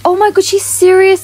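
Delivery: high, loud, stunned gasp; shocked that a stranger really gave her a bloodhound; gasping surprise. Real, not acted. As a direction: react like you can't believe it.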